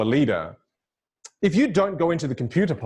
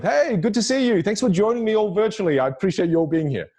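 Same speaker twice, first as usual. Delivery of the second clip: higher, open, friendly greeting; welcoming opener; 6 semitones higher than usual. Shown as a demonstration. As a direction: greet the audience with a welcoming lift in pitch. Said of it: "open a kind of conversation with".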